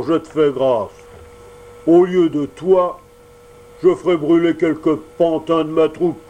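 bluster, then bellow for the guards; gruff Mangefeu. Acted. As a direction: showman summoning his gendarmes.

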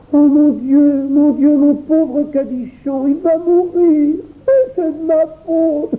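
put-on high, slow, tearful child's wail; pleurs d'enfant. A narrator's reading. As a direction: lament through tears, slowly. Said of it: "pleurant toujours". (enfant qui se lamente en pleurant, aigu et lent)

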